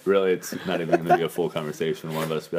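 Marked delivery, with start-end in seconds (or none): none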